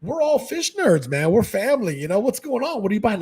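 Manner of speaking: high, pushy-friendly chatter; full-on friendliness. Shown as a demonstration. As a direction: come on strong like family, pitched high. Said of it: "personality is a little bit, I don't wanna say it's aggressive".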